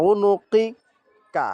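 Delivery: উনুকিকা stretched very slowly, each syllable held; slow drawn-out recitation. Shown as a demonstration. Teacher showing students how to say উনুকিকা, very slow and drawn out. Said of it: "নিচে যদি আবার দেখি".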